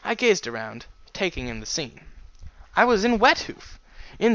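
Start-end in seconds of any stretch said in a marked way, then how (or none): 2.7-4.3 excited. Acted. Excited realisation.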